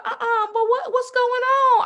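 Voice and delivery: high, indignant mock voice; offended friend. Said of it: "She's like".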